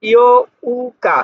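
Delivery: teacher draws out ইয়ৌকা for students; slow drawn-out model. Shown as a demonstration. Teacher models the date word, slowly and firmly. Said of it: "ইয়ৌকা আট তারিখ।".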